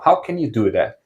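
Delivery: usual speaking voice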